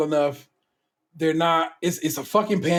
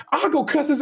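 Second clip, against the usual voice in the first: fired-up wife; 11 semitones higher than usual. Retold or imitated. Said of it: "And Vanessa was like".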